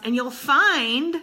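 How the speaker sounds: ordinary talk, nothing marked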